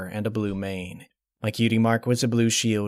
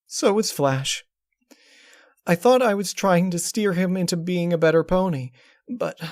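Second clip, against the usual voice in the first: Twilight; 7 semitones higher than usual. Acted. Twilight voiced.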